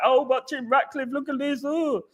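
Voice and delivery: high, soft gushing fan voice; mocking gullible fans. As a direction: play a starstruck fan in awe, high and breathy-soft.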